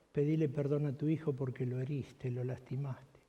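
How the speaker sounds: gentle voice of God. Retold or imitated. God speaks to the pastor.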